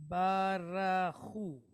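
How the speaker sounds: "Barahu" drawn out syllable by syllable, ba-ra-hu; slow Hebrew reading. Shown as a demonstration. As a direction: read "Barahu" slowly, each syllable clear. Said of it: "how are we gonna pronounce".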